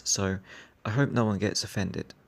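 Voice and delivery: ordinary talk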